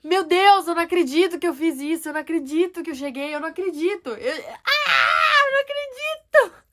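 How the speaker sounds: high, loud giddy freak-out building to a shriek; laughing disbelief. Retold or imitated. Woman alone in car after praying, laughing in disbelief. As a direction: burst out in overwhelmed disbelief. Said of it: "Eu comecei a rir".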